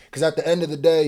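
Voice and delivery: ordinary delivery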